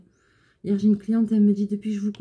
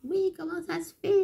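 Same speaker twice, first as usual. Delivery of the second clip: mocking caller; 9 semitones higher, 4 dB quieter than usual.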